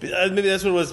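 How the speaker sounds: ordinary talk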